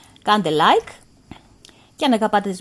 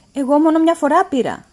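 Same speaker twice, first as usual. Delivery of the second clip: mild tremble, a touch louder and higher; a hint of a shaky plea; trembling voice; 6 semitones higher, 5 dB louder than usual. A narrator's reading. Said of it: "είπε με τρεμάμενη φωνή".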